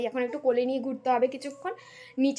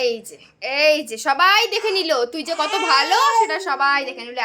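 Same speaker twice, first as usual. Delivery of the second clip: playful scolding; 5 semitones higher, 8 dB louder than usual. Real, not acted. Mother mock-scolding the baby who woke up.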